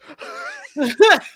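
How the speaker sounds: high, loud laugh breaking out; burst of high laughter. Real, not acted. She cracks up at her own comeback in a high, loud laugh.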